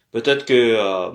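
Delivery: ordinary delivery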